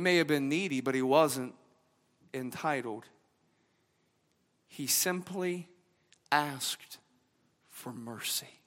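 preacher softening into a tender close; hushed preaching. Acted. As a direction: let the words fall to a near hush.

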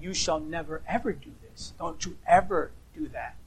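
low, hushed, grave "never, ever"; soft, stern command with weight on "ever"; absolute prohibition. Acted. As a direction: forbid it quietly and firmly. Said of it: "the other way you can express no".

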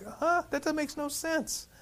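high, baffled and quiet disbelief; mock bewilderment, high and soft; baffled huh. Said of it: "that's how I'm like".

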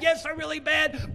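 loud emphatic shout; preacher shouting back at a doubting listener. Real, not acted. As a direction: shout the agreement out. Preacher answers objection shouting.

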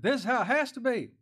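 high, loud imitation of someone insisting; acted bossy certainty, high and emphatic; mock insistence. Acting people who insist.

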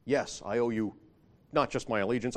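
normal talking voice

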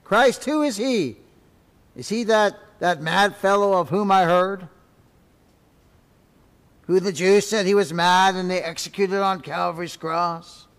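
a scholar scoffing at Christ; scornful learned man. Acted. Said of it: "The learned man stopped and said".

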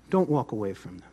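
low, soft, understated advice; hushed and low, holding back; quiet setup. Shown as a demonstration. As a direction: drop right down before the punch line. Soft setup before loud punch line.

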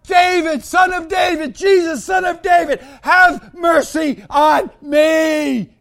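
high, loud pleading shout. Acted. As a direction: shout it over the crowd, drawing out the end. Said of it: "cry out even louder".

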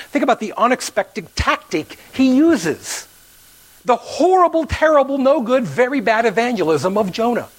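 mock outrage at Jonah's evangelism; hammed-up exasperation. Real, not acted. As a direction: ham up the horrible, terrible list. Mock-dramatic list of Jonah's failings.

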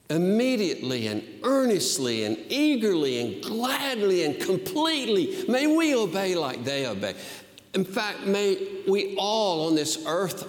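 high, fervent preacher's cry; fervent pulpit voice; impassioned preaching. Acted. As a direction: preach it out with fire. Preaching at full force.

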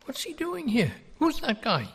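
high, puzzled mock double-take; hammed-up who's that guy; mock baffled surprise. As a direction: act out a confused stranger staring at Jesus. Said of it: "you'd say".

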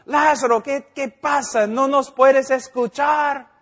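llamada más aguda y fuerte, lenta; grito suplicante imitado. Retold or imitated. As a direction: ruega a gritos que Lázaro responda. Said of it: "empezamos a gritar y orar". (súplica a gritos ante la tumba)

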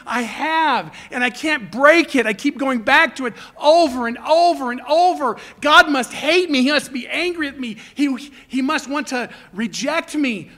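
loud, high, anguished mock confession; despairing sinner. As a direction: act out a believer tormented by guilt.

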